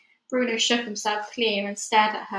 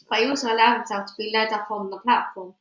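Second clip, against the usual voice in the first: ordinary delivery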